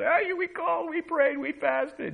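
very high mock whine; whining complainers. Mimics people whining to God.

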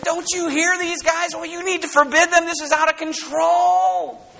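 high, rising protest, shouting at the end; irate high priest demanding silence; indignant priest. Acted. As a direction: complain like an outraged official. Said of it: "the high priest came up and said".

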